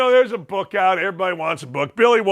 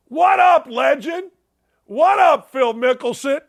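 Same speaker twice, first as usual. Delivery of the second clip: shouted greeting; 5 semitones higher than usual. Acted. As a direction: holler it like hailing a buddy. Host hails Mickelson loudly.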